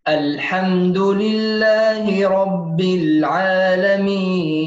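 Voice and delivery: slow, measured tajweed recitation with long held vowels; Quran recitation. Shown as a demonstration. Teacher recites the verse for students, slow measured recitation. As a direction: recite it slowly, drawing out the final syllable.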